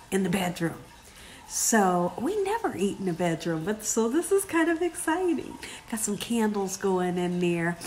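low, soft confiding voice; quietly excited, voice held low; hushed, near whisper. Real, not acted. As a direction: keep it down as if sharing a secret. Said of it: "why am I whispering?".

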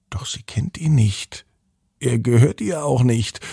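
plain reading voice

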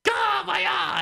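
high slow anguished cry; mock pained scream. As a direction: shriek like your eyes are burning. Vampire burning in sunlight.

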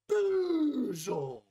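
over-the-top drawn-out wail; howled word. Acted. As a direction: cry it out like a battle howl. Dramatic fuel rant, climax.

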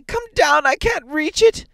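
high, strained, urgent scream; loud cry. A narrator's reading. Wife stuck at the bolt calling upstairs, loud cry. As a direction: shout desperately for help. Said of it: "she cried loudly".

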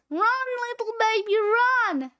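high, fast warning call; hammed-up cry of alarm; urgent cry. Urging baby to flee.

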